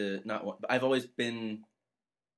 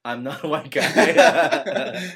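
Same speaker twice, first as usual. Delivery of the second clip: slow deadpan reveal; 5 semitones higher, 11 dB louder, much slower than usual. Real, not acted.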